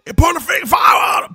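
high, loud mock yell; imitated scream. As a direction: yell it like a frantic manager at ringside. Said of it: "is screaming".

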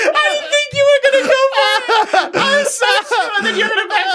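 high, fast, squealing through laughter; helpless laughter. Real, not acted. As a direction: crack up while getting the words out. Cracking up at the impression.

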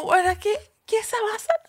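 high, soft mock crying; hammed-up weepy question; tearful bewilderment. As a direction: squeak it out in despair. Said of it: "ya, ahí ya llorando".

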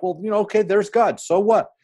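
mock shrug. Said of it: "we just like".

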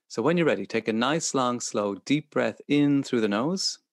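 calm counting through each breath; soothing, unhurried breathing guide; guided meditation. Acted.

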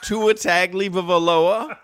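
Tagovailoa said "TAG-lee-vuh-vuh-LOH-uh", slow; imitated mispronunciation. Host mimicking a rapper's botched attempt, amused mispronunciation. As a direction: stumble slowly through Tagovailoa with extra syllables, laughing. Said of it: "was trying to say Tua Tagovailoa".

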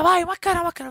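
imitating relatives' panic, high and loud; hammed-up alarmed cry; mock hysterical panic. Family panics over the baby, mock hysterical. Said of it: "ואז כולם בהיסטריה".

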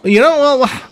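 high, loud, folksy Minnesota bluster; exasperated car salesman. Acted. Salesman giving in to the questioner, exasperated and high. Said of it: "acting out a scene from the film Fargo".

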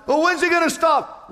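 high, loud whiny question from a weary believer; mock congregant's plea. Preacher voicing a weary believer asking God, high and loud. Voiced believer complaining about trials.

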